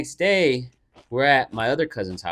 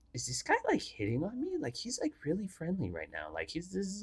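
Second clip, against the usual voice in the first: soft, higher-pitched aside; very quiet, uncertain retold thought; hushed wondering; 5 semitones higher, 14 dB quieter than usual. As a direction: puzzle it out under your breath, imitating a cousin. Cousin's private doubt, retold.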